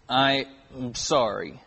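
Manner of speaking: put-on low, soft, reluctant kid's sorry; half-hearted apology. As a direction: mumble a token apology, imitating a told-off child. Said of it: "And so we would go".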